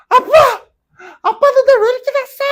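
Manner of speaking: put-on a moody teenage girl screaming at her father; shouting teen daughter. A documentary daughter yelling at her dad in a shout. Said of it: "소리를 지르는 거야".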